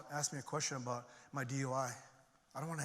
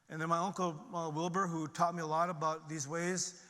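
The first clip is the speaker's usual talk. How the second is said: usual speaking voice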